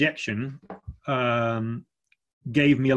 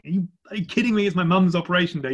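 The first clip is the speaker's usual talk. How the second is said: higher, fast mock outrage at a prankster; a retold phone rant, pitched up and quick; exasperated outburst; 6 semitones higher, faster than usual. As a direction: act out the fury he nearly let loose. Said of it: "unleash blue language down the phone".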